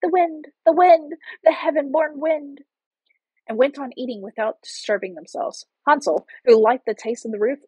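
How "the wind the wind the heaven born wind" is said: put-on high-pitched children's reply; children answering. A narrator's reading. Children answering the witch from outside, high childlike chant. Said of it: "The children answered".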